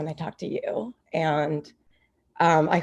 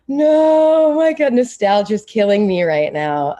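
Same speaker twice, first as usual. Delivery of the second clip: louder, higher mock cry of nostalgia; overwhelmed outcry; 5 semitones higher, 9 dB louder, slower than usual. Retold reaction to seeing Mark, louder and higher. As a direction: replay the moment Mark appeared, loud and high. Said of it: "I'm like".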